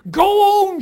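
huge, high yell. A narrator's reading. The farm wife yells at God over the pasture. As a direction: roar it across the pasture. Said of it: "she yelled".

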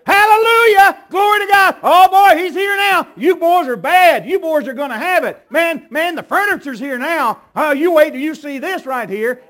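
loud, high crowing over the enemy; excited victory shout. Acted. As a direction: gloat at full voice, the furniture has arrived. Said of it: "what kind of shout did they shout with?".

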